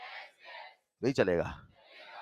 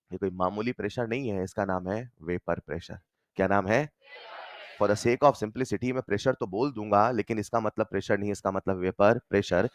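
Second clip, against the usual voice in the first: much lower-pitched, weighty explanation; solemn low register; 10 semitones lower than usual. Real, not acted. Teacher warning class what pressure really means, solemn low register. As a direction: drop low to lay down an important caveat. Solemn naming of a key term.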